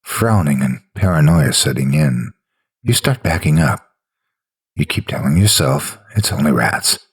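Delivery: usual speaking voice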